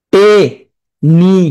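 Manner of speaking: টে-নি-আস-লি said very slowly, each syllable apart; broken into syllables. Shown as a demonstration. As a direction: break টে-নি-আস-লি into separate slow syllables. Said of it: "ভেঙে ভেঙে খাতার মধ্যে লিখবে". (টে-নি-আস-লি ধীরে ধীরে ভেঙে ভেঙে বলা)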